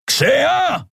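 high roar across a ship; full high-pitched yell; bellowed call. A narrator's reading. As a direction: bellow the name to someone far off. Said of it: "brüllte er quer durch das Schiff".

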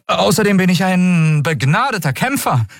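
put-on a nervous boy overselling himself; boastful young Miko. Miko bragging to the captain, high and eager.

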